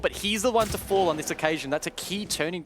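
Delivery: a caster's hype on a big moment; excited kill call. Real, not acted. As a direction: call the turning-point kill with a lift. Caster calling a key kill.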